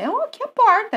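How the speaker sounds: porta with a heavy R; rolled R. Shown as a demonstration. Teacher shows her own heavy R in porta. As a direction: lean hard on the R in porta. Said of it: "O R, ele vem carregado".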